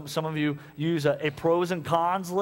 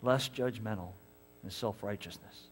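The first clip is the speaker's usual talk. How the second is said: lower, softer, slow gentle voice; softer lower gentle divine voice; voice of God; 6 semitones lower, 6 dB quieter than usual. Acted. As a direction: speak as a patient God. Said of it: "He's gonna say".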